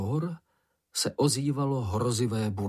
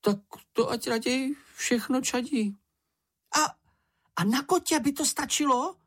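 very high-pitched little character; high character voice, ending in a question; Čenich's high voice; 13 semitones higher than usual. A narrator's reading. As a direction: speak up in a tiny high voice. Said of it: "pokračoval Čenich".

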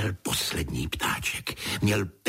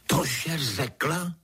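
drawn-out, high-pitched disbelief; astonished exclamation; 7 semitones higher, much slower than usual. A narrator's reading. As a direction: cry it out slowly, pitched up, incredulous. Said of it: "vykřikla paní".

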